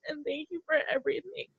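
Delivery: thanking through tears, voice almost gone; tearful thanks. Real, not acted. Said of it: "And I'm sorry for crying".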